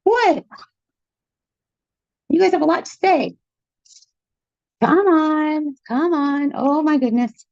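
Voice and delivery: high, cooing pet voice; talking to a dog. Real, not acted.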